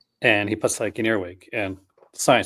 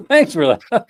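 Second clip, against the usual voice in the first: high, laughing mock gratitude; mock thanks for a new nightmare; sarcastic thanks; 10 semitones higher, 5 dB louder than usual. Real, not acted. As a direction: thank them sarcastically, cracking up.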